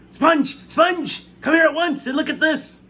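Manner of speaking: Aunt Spiker calling her sister over in excitement; shrill shout. A narrator's reading. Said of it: "Aunt Spiker was shouting".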